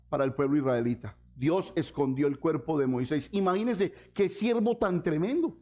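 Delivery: ordinary delivery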